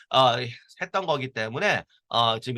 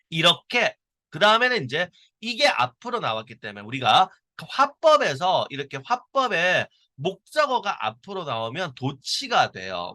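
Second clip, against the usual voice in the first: ordinary delivery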